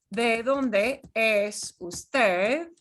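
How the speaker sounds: slow, careful teacher question; slow and clear. Shown as a demonstration. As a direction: ask it slowly for learners. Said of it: "answer the question".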